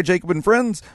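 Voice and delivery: normal talking voice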